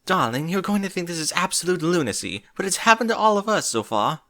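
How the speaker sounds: high, posh-free lightly panting pony voice; breathless Rarity. A narrator's reading. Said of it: "still panting a little".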